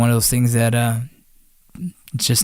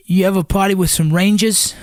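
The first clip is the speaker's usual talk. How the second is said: higher-pitched mock Boston accent, dropped r's; 6 semitones higher than usual. Buddy boasting to girls about Rangers, imitated Boston accent. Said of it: "he's from, like, Boston or something".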